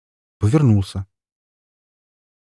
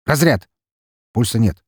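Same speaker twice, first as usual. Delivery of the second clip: команда; 4 semitones higher, 5 dB louder than usual. Acted. Реанимация.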